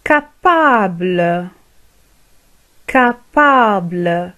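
Capable drawn out very slowly, every syllable clear; very slow teacher model of Capable; slow model word. Shown as a demonstration. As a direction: say Capable slowly for learners to repeat. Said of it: "teacher models word".

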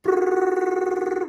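very high, loud rolled brrr; alarm ringing. Acted. The alarm clock going off, loud high ring.

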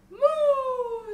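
high, loud long moo; cow's moo. A narrator's reading. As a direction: moo out boldly like a cow. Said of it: "said the cow".

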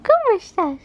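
put-on high cooing baby talk. Mother coos at baby.

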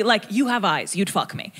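usual speaking voice

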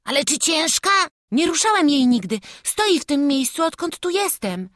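put-on high, loud child voices; urgent, high-pitched boy; anxious boys. A narrator's reading. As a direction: confer hurriedly as scared lads. Two boys hurriedly confer.

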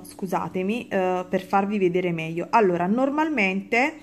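normal talking voice

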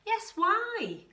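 high, loud chiming-in call. A narrator's reading. Dingoes calling up to Kevin in his tree, calling out. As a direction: call up loudly, joining the others. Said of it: "called the Dingoes".

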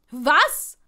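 loud, rising exclamation; shocked outburst. A narrator's reading. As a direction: blurt it out in disbelief. Startled man shouts into call.

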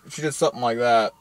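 normal talking voice

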